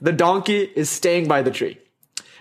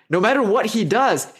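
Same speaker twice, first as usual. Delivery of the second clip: ordinary delivery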